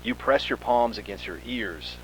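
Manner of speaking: ordinary delivery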